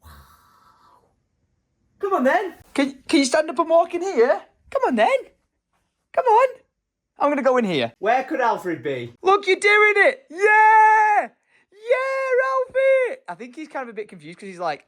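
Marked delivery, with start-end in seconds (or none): 2.0-6.5 much higher, louder come-here call; high coaxing call. Real, not acted. Calling baby to walk over. 9.2-13.2 high, loud joyful yell; real delighted shout; excited cheering. Real, not acted. Baby walks, parent cheers.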